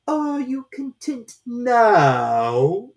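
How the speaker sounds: very slow, loud, strange drawn-out voice; slow caterpillar drawl. A narrator's reading. Caterpillar lazily questioning Alice, very slow and loud. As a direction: stretch every word lazily, from on high.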